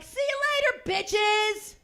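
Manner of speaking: brash shouted see-ya; loud farewell shout. Pretend. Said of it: "I don't say it that loud".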